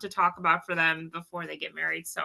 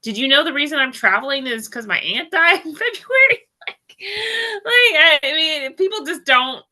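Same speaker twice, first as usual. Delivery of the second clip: tearful; 8 semitones higher, 6 dB louder than usual. Real, not acted. Upset telling of aunt's death.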